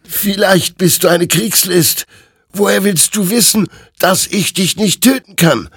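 desperate questioning. A narrator's reading. Hector doubting the creature, high and frantic. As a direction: push back in rising panic. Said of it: "fragte Hector".

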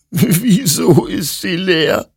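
a broken man on the edge of tears; mock sobbing. A narrator's reading. Hector sobbing at the empty gun, lightly acted. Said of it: "schluchzte Hector".

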